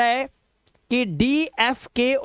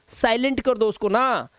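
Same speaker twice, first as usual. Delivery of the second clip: ordinary talk, nothing marked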